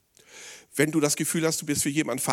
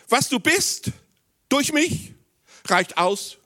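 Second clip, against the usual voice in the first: high, loud preaching of the promise; ringing pulpit voice; emphatic Jesus quote; 13 semitones higher, 6 dB louder, much slower than usual. Acted.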